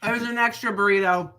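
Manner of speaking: chewing while talking; words round a bite of food; mouth full. Real, not acted. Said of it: "Don't talk with your mouth full".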